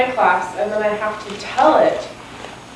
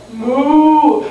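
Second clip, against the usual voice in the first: long, higher-pitched imitated moo; cow moo; 4 semitones higher, 4 dB louder than usual. Storyteller voicing mixed-up chickens, drawn-out cow moo. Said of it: "like cows".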